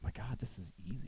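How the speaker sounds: hushed whisper. Retold or imitated. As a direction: breathe it as a secret thought. Retold thought whispered.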